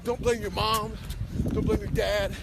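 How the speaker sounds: ordinary delivery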